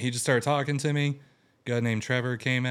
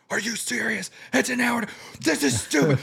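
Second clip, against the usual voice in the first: much higher, louder furious rant at the screen; retold screaming fit; 13 semitones higher, 4 dB louder than usual. Man yelling at his computer about service times, higher and louder, furious. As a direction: reenact raging at the computer over the long service. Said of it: "screaming and cussing".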